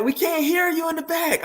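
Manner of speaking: imitating a crowd member yelling from the back; crowd calling out. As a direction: call out that you can't hear him.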